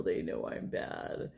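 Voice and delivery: put-on a hushed, fearful confession in a child's voice; guilty kid's whisper. The reader voicing a child caught being naughty, whispering. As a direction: whisper it low, like a scared kid caught out.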